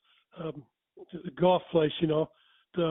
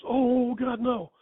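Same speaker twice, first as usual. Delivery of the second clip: higher, louder sudden alarm; he just remembered the fence is on; retold gasp of alarm from the doorman; alarmed realization; 6 semitones higher, 3 dB louder, much slower than usual. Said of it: "And the guy goes".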